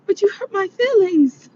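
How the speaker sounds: put-on high, wounded little-girl voice; high-pitched mock pouting; imitating sensitive daughter. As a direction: mimic a child's hurt complaint. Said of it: "she's just so sensitive".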